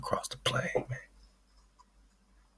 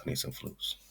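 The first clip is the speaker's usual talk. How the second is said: whispered; 15 dB quieter than usual. Real, not acted. Repeating his need for flutes, whispered. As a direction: whisper it, unvoiced and soft.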